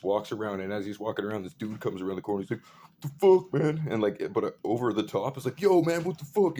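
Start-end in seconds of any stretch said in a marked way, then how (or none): 3.0-3.9 high, loud outburst of indignation; retold yell. Dude rounding the corner at the guy, yelling. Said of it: "he just starts yelling at this guy". 5.6-6.6 high-pitched, loud challenge; retold yell. Dude confronting the guy over the fence, yelling. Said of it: "he just starts yelling at this guy".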